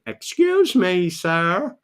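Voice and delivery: put-on hammy American accent; mock American accent. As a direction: put on a slow American "excuse me, sir". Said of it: "That's my accent at the moment for the Americans".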